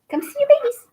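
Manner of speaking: very high sing-song call; calling the dog. Real, not acted. Owner calling her dog to the camera, high coaxing call. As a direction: coax the dog over in a high voice.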